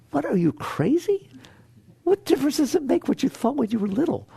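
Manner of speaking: high, mocking disbelief; mock outrage at the mind; incredulous scoff. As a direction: scoff at the silly idea. Scolding the mind's reaction.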